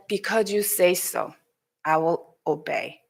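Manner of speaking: low, subdued, reluctant obedience; sad, slightly annoyed. Acted. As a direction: give in softly with a touch of annoyance. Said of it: "Sadly, he said, he was a little annoyed".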